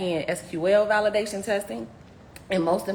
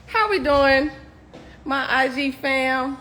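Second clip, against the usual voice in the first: ordinary talk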